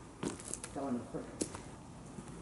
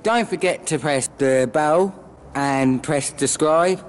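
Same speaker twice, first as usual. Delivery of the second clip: louder and slow, hammed-up outro call to action; mock subscribe plea; 6 dB louder, slower than usual. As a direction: drag out every word like a cheesy channel outro. Parody subscribe plea, slowed down.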